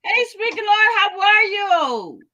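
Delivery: high, upbeat outro call to subscribe; outro announcement. Acted. Host asking viewers to subscribe, in a high upbeat outro voice. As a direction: pitch the channel like a host signing off.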